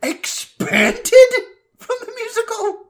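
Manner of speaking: high, incredulous disbelief; shocked. Real, not acted. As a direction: gasp it out in disbelief. Shocked at expanded role.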